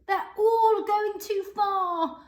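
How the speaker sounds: high, slow, worried cry. A narrator's reading. Charlie crying out that they went too far, high and worried. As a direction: cry out in worry. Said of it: "cried Charlie".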